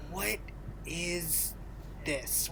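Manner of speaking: soft, halting word-by-word puzzlement; hushed, drawn-out bewilderment; baffled disbelief. Retold or imitated. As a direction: imitate someone quietly baffled, pausing after each word. Imagined baffled reaction to fake accent.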